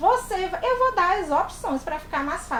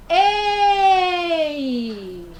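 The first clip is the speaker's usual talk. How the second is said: a loud "Ei!" to get attention; a bright, loud hey; calling out; 4 semitones higher, 5 dB louder than usual. Shown as a demonstration. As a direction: call someone across the room. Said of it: "Ei também é uma palavrinha".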